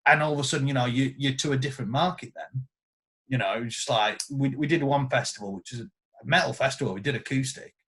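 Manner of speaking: normal talking voice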